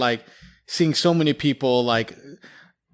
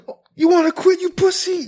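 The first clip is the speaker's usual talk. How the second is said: mocking drill sergeant. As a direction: berate a recruit in front of everyone. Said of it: "that drill sergeant, like, put him on blast".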